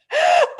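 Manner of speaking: a sudden high squeal of a laugh. Real, not acted. Losing it again mid-story, high laugh.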